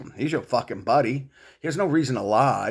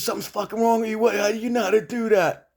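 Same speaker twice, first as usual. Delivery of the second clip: much higher, alarmed buddy voice; imitating TJ; 11 semitones higher than usual. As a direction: squeak it out like a spooked friend. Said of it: "He goes".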